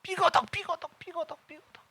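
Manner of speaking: creaking zombie noise. Retold or imitated. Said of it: "이게 좀비 같아".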